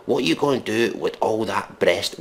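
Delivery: ordinary delivery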